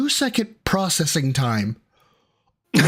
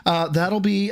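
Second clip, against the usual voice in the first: ordinary delivery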